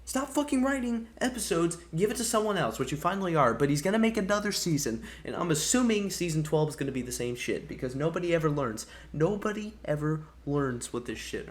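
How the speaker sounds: pissed-off rant. Real, not acted. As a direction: vent real fury at the writer. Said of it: "I got really pissed off".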